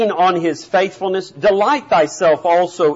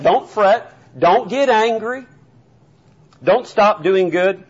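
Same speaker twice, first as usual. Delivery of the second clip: ordinary talk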